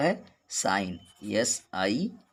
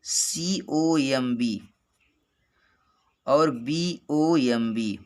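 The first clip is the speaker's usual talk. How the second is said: slow spelling out; much slower than usual. Shown as a demonstration. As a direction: spell सीओएमबी letter by letter, slowly. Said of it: "यम के बाद बी silent रहता है". (धीरे धीरे spelling)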